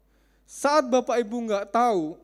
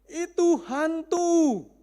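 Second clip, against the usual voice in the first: high, slow, drawn-out scared cry of ghost; frightened cry; 8 semitones higher, 6 dB louder, much slower than usual. Retold or imitated. Terrified disciples in the storm cry out, high and slow.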